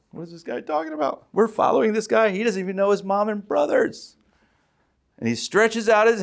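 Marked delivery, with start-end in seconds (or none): 0.1-4.2 a hint of baffled disciples, higher; mock the confused followers lightly; puzzled apostles. Preacher imagining the apostles muttering, lightly baffled. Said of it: "You imagine the apostles are like".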